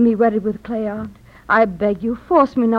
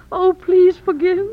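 tearful plea; 5 semitones higher than usual. Acted. Daughter begs dead father's forgiveness.

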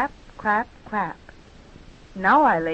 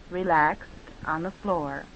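ordinary talk, nothing marked

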